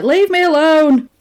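hammed-up, exasperated plea; mock angry outburst. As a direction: act out a husband snapping at his wife. Acts husband snapping at wife.

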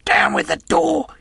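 high, strained shout; commanding yell, pitched right up; cried command. A narrator's reading. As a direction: bellow it as an order to the gang. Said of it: "he cried".

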